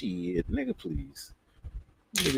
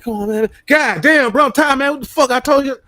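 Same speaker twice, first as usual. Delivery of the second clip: much higher, loud furious yell; crashing out; 13 semitones higher, 9 dB louder than usual. Retold or imitated. Snapping at a friend who won't stop asking, furious yell. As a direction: finally snap and let it all out. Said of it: "I crashed out and fucking spazzed".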